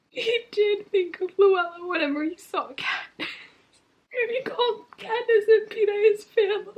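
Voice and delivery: high-pitched real crying. Real, not acted. Reader moved by Haymitch's epilogue, crying. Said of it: "I haven't cried like this".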